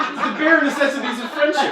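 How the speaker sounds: laughing through the pun; giggling while talking. Real, not acted.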